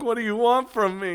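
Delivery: high-pitched, put-upon mock plea; mock exasperation. Co-host voicing a reaction to mixed signals, mock exasperated. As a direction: act baffled and helpless, pitched right up.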